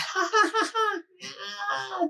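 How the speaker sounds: high squealing baby giggle, mimicked; imitating baby's shrieking laugh. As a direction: copy the baby's little scream-laugh. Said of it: "doing his little scream thing".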